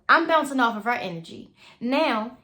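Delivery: usual speaking voice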